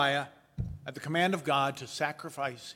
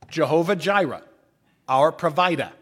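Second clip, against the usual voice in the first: loud Boston accent with dropped r; 7 dB louder than usual. Shown as a demonstration. Preacher makes the Hebrew name rhyme, Boston accent. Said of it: "rhymes, but only in Boston".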